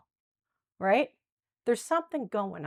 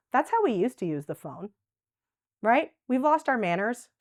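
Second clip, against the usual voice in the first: ordinary talk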